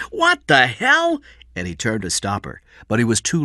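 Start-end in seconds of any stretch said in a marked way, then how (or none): 0.1-1.2 shocked. A narrator's reading. Eddie shocked at Kathy.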